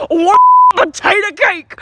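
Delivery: bully pushing his offer hard; loud tough guy. Retold or imitated. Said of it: "And he's like".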